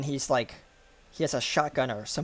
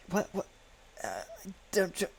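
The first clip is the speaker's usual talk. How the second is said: frightened stammer; 6 semitones higher, 5 dB quieter than usual. Acted. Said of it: "complete fear right now".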